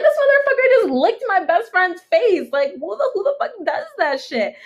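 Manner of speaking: furious but half laughing; outraged. Retold or imitated. Telling the girls what the guy did, high laughing outrage. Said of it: "And I was like".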